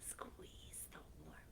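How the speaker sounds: softly whispered storybook line. Acted. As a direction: breathe it out like a secret.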